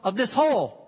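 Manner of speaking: loud, high-pitched desperate shout; yelling for help. Acted. Man trapped in a hole calls for anyone, yelling. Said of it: "he just starts yelling".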